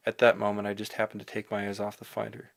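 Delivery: ordinary delivery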